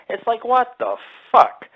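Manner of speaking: high, slow, disbelieving; exasperated what the fuck. Retold or imitated. Ranting about a buddy's detour, exasperated. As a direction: drag it out in disbelief.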